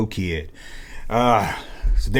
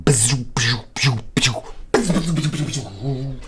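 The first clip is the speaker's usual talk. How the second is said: lightsaber buzz; 5 dB quieter, much slower than usual. Retold or imitated. Lightsaber duel noise.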